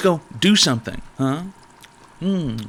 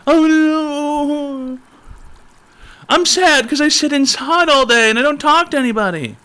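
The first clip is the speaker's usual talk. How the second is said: high, loud whiny sad-sack voice; mocking whiny voice; 12 semitones higher, 5 dB louder than usual. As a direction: whine it like a pathetic loser. Mocking the depressed viewer.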